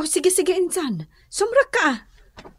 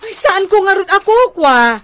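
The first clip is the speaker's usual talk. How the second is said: loud, raised protest; loud denial; 5 semitones higher, 6 dB louder than usual. Acted. Neighbour loudly denying he left the trash. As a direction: insist it isn't yours.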